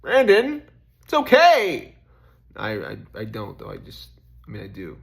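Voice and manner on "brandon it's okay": high, loud call of comfort; loud, high insistent self-reassurance. Retold or imitated. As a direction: call your own name out firmly. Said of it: "Just like".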